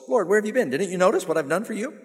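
high-pitched complaint to God; mock whining. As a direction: plead your case like a hurt complainer. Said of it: "call it Wine and Pine Ministries".

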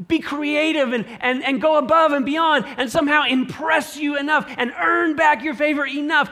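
high, loud, rushing bargaining; blubbering plea. Acted. Believer bartering with God, frantic mock pleading. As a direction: babble promises, falling over yourself. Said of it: "this very same blubbering servant".

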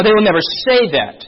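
ordinary talk

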